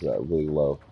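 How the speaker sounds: ordinary delivery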